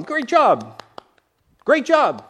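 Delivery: high, lightly puppet-like praise; Gonzo voice. Retold or imitated. Gonzo praising fellow dancers in a high mock puppet voice. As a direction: play the Muppet cheering his partners. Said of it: "the middle Gonzo is going".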